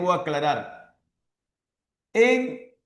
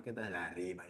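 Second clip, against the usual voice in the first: mock student; 11 semitones lower, 19 dB quieter, faster than usual. Teacher mocks a student boasting.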